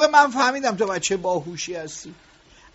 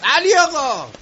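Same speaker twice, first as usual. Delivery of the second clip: loud, higher call after him; 3 semitones higher, 7 dB louder than usual. Acted. Boss calling the departing worker back, loud.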